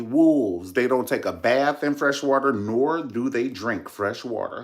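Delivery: ordinary talk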